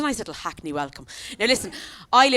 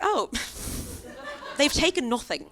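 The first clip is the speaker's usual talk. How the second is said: higher-pitched, mock-affronted realisation; dawning offence; 7 semitones higher than usual. Realising burglars wanted none of it, in higher, mock-offended dismay. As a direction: let it sink in as a comic insult. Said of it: "And then I was like".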